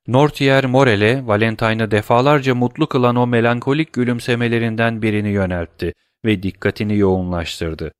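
ordinary delivery

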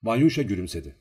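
ordinary delivery

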